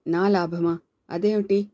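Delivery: ordinary delivery, nothing marked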